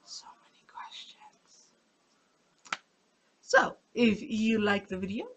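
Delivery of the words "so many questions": whispering. Pretend. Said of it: "I'm gonna get through the series and go".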